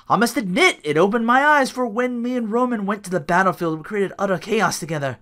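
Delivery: high, lightly theatrical promo voice; Bray Wyatt impression. Quoting a wrestler's promo, high and lightly theatrical. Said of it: "Bray Wyatt with his family says".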